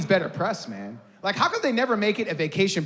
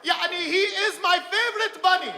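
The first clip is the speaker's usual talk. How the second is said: very high-pitched fan voice; gushing fan; 14 semitones higher than usual. Acted. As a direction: gush in a high squeal. Acting out an excited fan.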